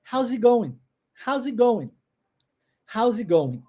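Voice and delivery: "How's it going?" with the final G left off; dropped G. Shown as a demonstration. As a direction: let "going" end on the vowel, no G. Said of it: "não pronuncia o G lá no final".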